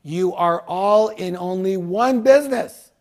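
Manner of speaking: builds to a loud, slow declaration; mock yelling out. Seminar leader answers the crowd, yelling out emphatically. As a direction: proclaim it to the whole room, drawn out. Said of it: "I, I yell out".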